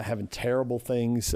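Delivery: usual speaking voice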